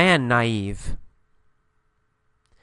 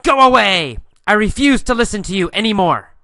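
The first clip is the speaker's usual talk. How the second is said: higher, louder angry outburst; acted yell; 6 semitones higher, 6 dB louder than usual. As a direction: yell it to send someone away. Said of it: "I yell at him".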